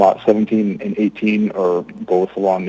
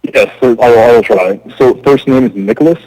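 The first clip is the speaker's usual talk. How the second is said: raised voice, louder on request; speaking up; 3 semitones higher, 7 dB louder than usual. Real, not acted. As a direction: make yourself heard. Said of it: "speak a little louder".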